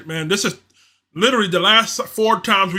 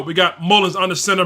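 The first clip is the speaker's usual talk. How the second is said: ordinary talk, nothing marked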